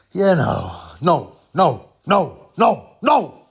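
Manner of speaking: rising refusal. Retold or imitated. Building refusal.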